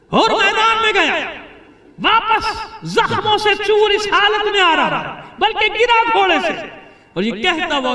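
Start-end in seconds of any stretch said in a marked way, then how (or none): 0.1-6.5 very high, loud wailing narration; impassioned lament. Acted. Preacher telling mourners of the wounded man's fall, impassioned cry. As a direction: cry out each phrase at the top of the voice. Preacher's climax as Hur falls.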